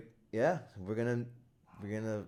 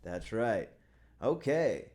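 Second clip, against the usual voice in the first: normal talking voice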